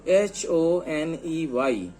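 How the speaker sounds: के ई वाई spelled letter by letter, slowly; slow spelling out. Shown as a demonstration. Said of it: "दूसरा example देखिए". (अक्षर-अक्षर धीरे बोलकर वर्तनी)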